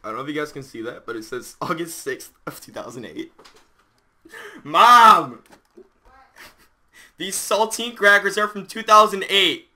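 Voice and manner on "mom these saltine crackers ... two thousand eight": loud call to another room. Real, not acted. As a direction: shout for mom, slow and loud. Calling to mom across house.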